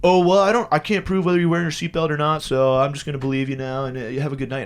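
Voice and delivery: loud, high start, easing down; cop role. Retold or imitated. Cop deciding to believe the driver, mock cop voice. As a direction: imitate a cop conveniently backing off. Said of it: "He was like, he was like".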